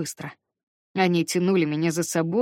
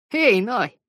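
calling out; 5 semitones higher, 5 dB louder than usual. Acted. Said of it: "внезапно позвал Шуйлер".

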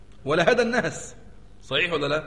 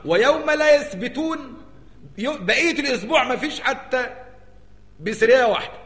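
emphatic high peak; 8 semitones higher, 5 dB louder than usual. Shown as a demonstration. Climax of the verse.

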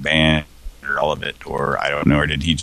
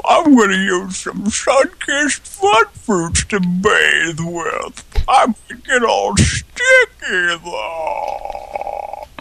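high, slow commercial voice; much slower than usual. Pretend. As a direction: ham it up like a TV ad. Mock commercial voice.

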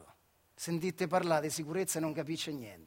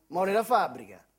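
normal talking voice

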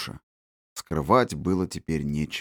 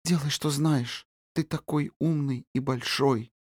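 soft, sleepy murmur; barely whisper, drifting off; 6 semitones higher, 5 dB quieter than usual. Acted. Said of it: "прошептала девочка".

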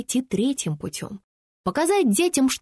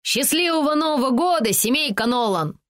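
put-on Irish accent; 5 semitones higher, 3 dB louder than usual. A narrator's reading. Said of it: "сильным ирландским акцентом".

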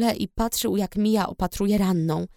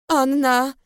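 a sudden shout of her name, louder and higher; 6 semitones higher, 4 dB louder than usual. A narrator's reading. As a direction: cry out in shock. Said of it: "krzyknął".

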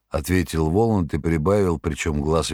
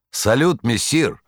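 high, loud cheery hail; shouted greeting; 6 semitones higher, 3 dB louder, slower than usual. A narrator's reading. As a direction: call out a rowdy greeting. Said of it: "прокричала неугомонная парочка".

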